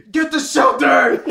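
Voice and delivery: high, loud, slow screech. Acted. Watcher screeching a warning to the group. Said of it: "screeched Watcher".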